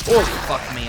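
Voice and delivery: startled, blurted out loud; sudden loud curse. Real, not acted. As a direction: blurt it as it hits you. Suddenly remembers the dog.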